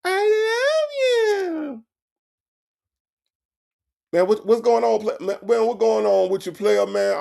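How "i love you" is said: very high, slow crying falsetto; high mocking sob voice; mock whiny love. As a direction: wail it in a squeaky fake sob. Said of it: "You heard that?".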